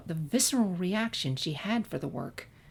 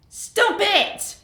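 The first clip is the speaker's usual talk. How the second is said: put-on high, loud childish shriek; 8 dB louder than usual. A narrator's reading. Young Sunset yelling at the schoolyard bullies, high shriek. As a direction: scream it like a cornered little filly.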